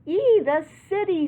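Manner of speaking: high, loud squealed exclamation; piercingly loud pretend pig voice. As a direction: squeal it out like an excited storybook pig. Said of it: "squeals the old pig".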